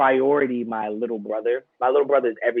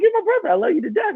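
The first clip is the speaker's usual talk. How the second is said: put-on much higher, eager kid brother; little brother's voice; faster than usual. Younger brother reassuring his big brother, high eager voice. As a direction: squeak it out like a devoted fourteen-year-old.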